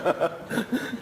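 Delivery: short high laugh breaking out; high burst of laughter. Real, not acted. Laughs at having no money. (tawa lepas bernada tinggi)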